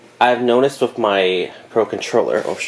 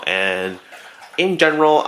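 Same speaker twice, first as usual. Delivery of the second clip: ordinary talk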